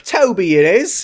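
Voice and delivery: fake British accent. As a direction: play a cartoon Brit. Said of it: "fake British accent".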